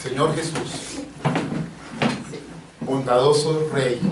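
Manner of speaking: hushed pastor's prayer over the congregation; soft prayer. Acted. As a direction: pray quietly to the Lord, drawing words out. Pastor leads closing prayer.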